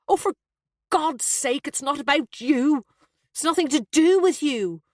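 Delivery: high, exasperated outburst. A narrator's reading. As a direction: protest in exasperation to the mother. Son in quarrel with tearful mother.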